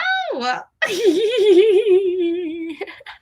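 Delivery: long, high delighted giggle; giddy laugh. Real, not acted. Greeting the new toy, long giddy high laugh.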